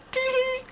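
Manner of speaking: gleeful little private chuckle; chortle. Acted. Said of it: "he chortled to himself".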